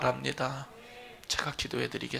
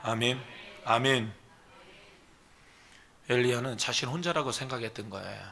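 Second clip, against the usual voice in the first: ordinary delivery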